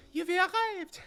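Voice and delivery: put-on high, girlish, anxious noblewoman; young lady voice. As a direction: ask about papa like a worried girl.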